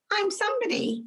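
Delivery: put-on high, small child's voice echoing his mum; a two-year-old proudly repeating; little son's voice. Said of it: "He goes".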